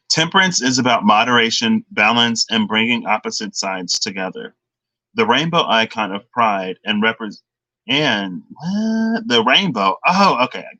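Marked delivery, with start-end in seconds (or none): 0.1-7.4 hurried read with short pauses; rushed reading. Real, not acted. Reader rushing through the Temperance card text, hurried. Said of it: "I need to slow down".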